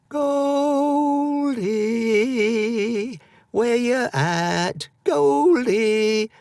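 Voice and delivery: put-on high child voice calling far into the woods; boy's voice, high and loud, calling for Goldie. A narrator's reading. Boy calling through the forest for his lost Goldie. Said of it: "calling to the Goldlock".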